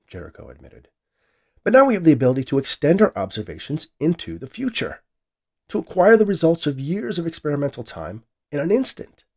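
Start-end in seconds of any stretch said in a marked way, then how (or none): 1.6-9.2 excited. A narrator's reading. Scientist enthuses about his invention.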